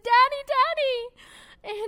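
put-on high, loud childlike call; imitating toddlers running to greet daddy; kids calling excitedly. Children calling for their father at the door, high excited shout.

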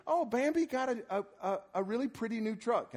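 high-pitched doting grandma voice; imitated grandmother. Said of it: "and she said".

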